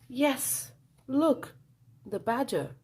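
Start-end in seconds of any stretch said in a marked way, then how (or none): none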